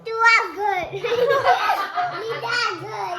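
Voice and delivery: loud, high burst of laughing; laughter. Real, not acted. Family teasing the child about beating, high loud laughter.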